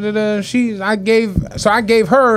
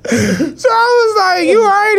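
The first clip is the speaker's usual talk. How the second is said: laughing; 12 semitones higher than usual. Real, not acted. Still laughing while retelling.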